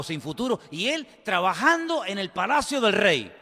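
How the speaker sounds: loud, punchy preaching with soaring peaks; impassioned preaching. Real, not acted. Preacher marvels at a job in the palace, impassioned. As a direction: drive each phrase home to the congregation.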